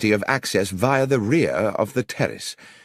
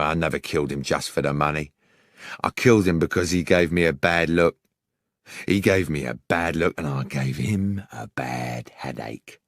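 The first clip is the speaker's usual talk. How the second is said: menacing criminal; 5 semitones lower than usual. Acted. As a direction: gloat over the kill, cold and lower. Killer Organ gloating menacingly.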